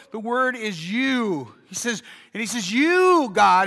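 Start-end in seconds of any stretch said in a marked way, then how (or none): 2.7-3.7 shouting out. Retold or imitated. Pastor voicing David calling out to God, loud. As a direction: cry it out to God. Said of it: "he's shouting this out".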